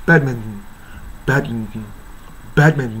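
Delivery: "バドミントン" as bad-m'n-n; blurred nasal ending on English "バドミントン"; American reduction. Shown as a demonstration. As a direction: hit bad, swallow the rest of "バドミントン". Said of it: "その歌もアメリカじゃ".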